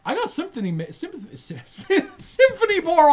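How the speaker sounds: high, fumbling the word, laughing; tongue-tied guard garbling sympathy; stumbling alien. Acted. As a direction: alien guard garbling his words, giggling. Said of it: "he's like".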